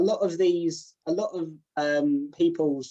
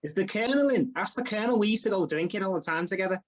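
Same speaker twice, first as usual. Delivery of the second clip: higher-pitched, matey Scouse; Scouse accent; 4 semitones higher than usual. Shown as a demonstration. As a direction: play a cheeky Scouse customer. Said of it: "Well, I can do Scouse".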